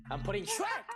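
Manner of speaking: squealing with glee; high laughing outburst; excited high shout. Real, not acted. Excited shout about Shrek.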